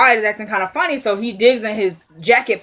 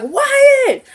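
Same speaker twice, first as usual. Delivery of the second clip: high yelled name; mother yelling her son's name, much higher; calling out; 14 semitones higher, 3 dB louder than usual. Retold or imitated. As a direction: shriek his name to stop him. Catherine calling out to Wyatt.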